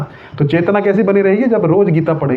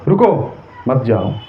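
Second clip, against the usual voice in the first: रुको alone, a break, then मत जाओ as one phrase; pause after रुको; 5 semitones lower, slower than usual. Shown as a demonstration. Teacher repeating the comma example to students, pause after रुको. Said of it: "एक कॉमे से कितना मतलब".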